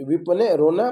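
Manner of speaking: ordinary delivery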